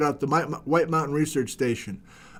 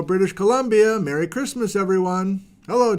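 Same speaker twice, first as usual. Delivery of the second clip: usual speaking voice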